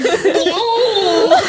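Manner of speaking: very high squeak of mock panic, half laughing; squealed, exaggerated shocked question; hammed-up alarm. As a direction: imitate him scandalised, pitch through the roof. Repeating the alarmed reaction, bigger.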